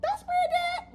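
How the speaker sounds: very high, loud laughing squeal; high falsetto mock denial. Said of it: "I'm like".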